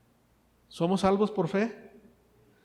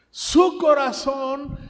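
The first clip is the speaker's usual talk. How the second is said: emphatic preaching; 6 semitones higher, 8 dB louder, slower than usual. Real, not acted. Preacher stresses Jesus's compassion.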